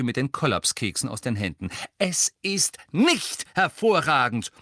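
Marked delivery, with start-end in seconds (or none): 2.0-4.5 slow, loud, high-pitched rebuke; angry contradiction. A narrator's reading. Hermine snatching the clipboard from Fred, angrily contradicting. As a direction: hammer each word, especially "nicht".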